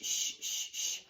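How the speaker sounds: drawn-out sh hiss; sh sound. Shown as a demonstration. Teacher models the sh sound at word end. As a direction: isolate the sh sound. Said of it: "dish, sh".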